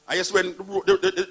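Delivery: usual speaking voice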